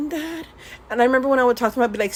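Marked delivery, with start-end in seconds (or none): 0.1-0.5 timid call. Retold or imitated. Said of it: "And I was like".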